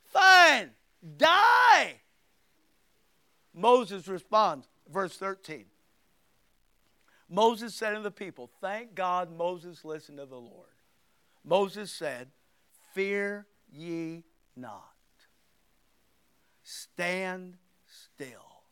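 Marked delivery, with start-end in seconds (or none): 0.0-2.0 higher, louder fed-up yell; exasperated shout. Retold or imitated. Preacher fed up with the complaining Israelites, exasperated shout. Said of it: "I would have said". 12.9-18.6 very slow and softer, steadying; calm hush. Acted. Moses calming his frightened people, slow soft hush.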